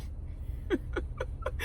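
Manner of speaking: soft, breath-only laugh. Real, not acted. Amused by the dismissal just retold, laughing. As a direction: wheeze a quiet laugh.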